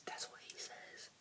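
hushed whisper to the viewers. Real, not acted. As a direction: whisper it secretly. Whispering to camera.

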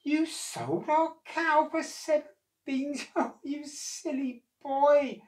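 high, slow, disbelieving with a laugh; a mother who can't believe it, laughing; mother scolding Jack, astonished, with a laugh. Acted. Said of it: "she replied, astonished".

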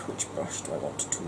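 usual speaking voice

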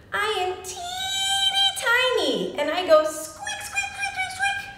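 high, squeaky little mouse; sing-song squeaks way up high; tiny mouse voice. Pretend. Teacher playing a tiny mouse for children, high squeaks. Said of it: "What kind of animal".

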